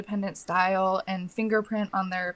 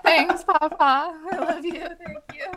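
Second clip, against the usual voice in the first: high sweet thanks; 8 semitones higher than usual. Retold or imitated. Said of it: "I'm like".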